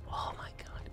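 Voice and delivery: breathless whisper; hushed, amazed whisper; awed whisper. Real, not acted. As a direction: whisper it in awe. Awed at a dream game idea.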